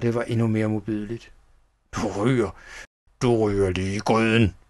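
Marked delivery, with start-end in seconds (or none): 1.9-4.6 restrained shout in a villain's voice; menacing shout. A narrator's reading. Schnickels threatening the child with the pot, menacing shout. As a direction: threaten them with contained force. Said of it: "råbte han".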